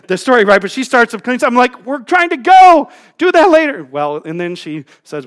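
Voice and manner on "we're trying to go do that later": high, loud mock hurrying; retold exasperated call to get moving; impatient yell. Husband to wife cleaning the car, impatient yell.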